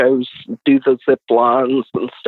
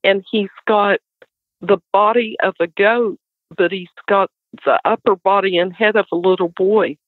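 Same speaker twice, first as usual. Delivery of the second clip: frightened son; 6 semitones higher than usual. Retold or imitated. Son describing the goat-boy to mom, high and frightened. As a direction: voice a scared boy recounting a monster. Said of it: "and he said".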